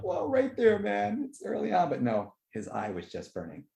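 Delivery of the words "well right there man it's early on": higher, admiring voice; impressed fan. Retold or imitated. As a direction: praise the scene with wonder. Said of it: "But I'm like".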